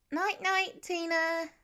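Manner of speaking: put-on high little child's voice; child waving goodnight to his hen; small boy. A narrator's reading.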